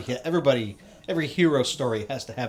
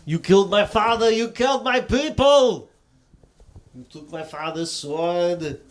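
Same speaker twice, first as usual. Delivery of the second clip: movie hero line; 5 semitones higher, 5 dB louder, slower than usual. Acted. Quoting Conan movie revenge line.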